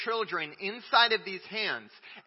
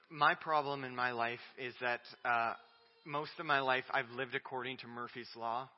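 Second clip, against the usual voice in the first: ordinary delivery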